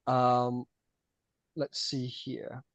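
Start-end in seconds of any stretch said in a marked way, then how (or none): none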